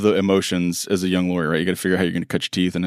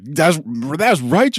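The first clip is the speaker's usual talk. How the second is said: stunned reply; 7 semitones higher, slower than usual. Retold or imitated. Young lawyer stunned by Tim's apology, higher stunned blurt. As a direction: blurt it out in shock. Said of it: "And I was like".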